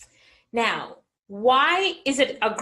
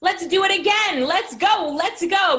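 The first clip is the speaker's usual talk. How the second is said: higher, louder rallying call; rallying cry; 6 semitones higher, 4 dB louder than usual. Retold or imitated. Speaker rallying her sister to start again, excited and loud. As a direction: fire up the team to restart. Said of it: "I said".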